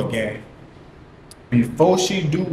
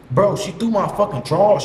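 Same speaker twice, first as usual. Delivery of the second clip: ordinary talk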